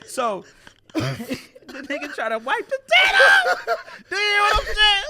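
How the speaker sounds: high, loud, slow talk broken by laughter; shouting through real laughter; laughing hard. Real, not acted. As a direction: barely get it out through laughing. Cracking up telling the story.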